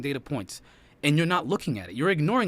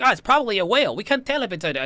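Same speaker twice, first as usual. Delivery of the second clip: mock confident guess; 9 semitones higher, 7 dB louder than usual. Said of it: "you're like".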